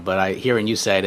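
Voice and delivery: ordinary talk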